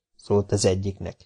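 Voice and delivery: plain reading voice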